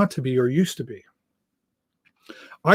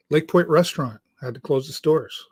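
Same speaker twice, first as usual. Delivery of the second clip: ordinary delivery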